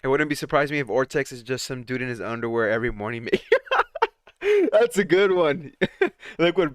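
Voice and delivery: reading it through laughter; giggling while reading aloud; laughing. Real, not acted. Reading a funny chat comment.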